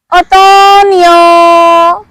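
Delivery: "Otoño" stretched out very slowly; very slow model. Shown as a demonstration. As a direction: draw out each vowel of "Otoño" for learners. Teacher stretches word out for learners.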